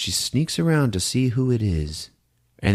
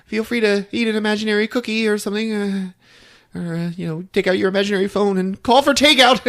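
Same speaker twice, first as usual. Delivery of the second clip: mock hostess; 13 semitones higher, faster than usual. Mock host to audience.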